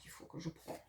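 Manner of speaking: breathy whisper to herself; whispered aside. Real, not acted. Crafter measuring paper, whispering to herself. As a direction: whisper it while concentrating.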